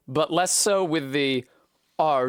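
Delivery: ordinary talk, nothing marked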